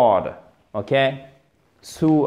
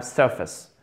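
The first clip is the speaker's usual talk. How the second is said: "surface." stressed on SUR, weak ending; first-syllable stress; 4 semitones lower, 5 dB quieter than usual. Shown as a demonstration. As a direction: punch the first syllable of "surface.", let the second drop. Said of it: "accent tonique sur cette syllabe". (« surface. » accentué sur la première syllabe)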